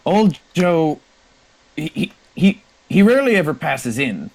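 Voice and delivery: high-pitched barmaid in character; amused barmaid. Acted. Barmaid answers question about old Joe.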